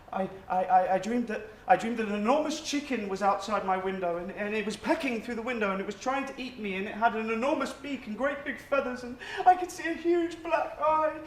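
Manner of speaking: stammering start, climbing to a high frightened cry; increasingly frantic, breathless run of words; panicked. Acted. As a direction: blurt out the nightmare, pitch rising as panic builds. Blurting out an invented nightmare.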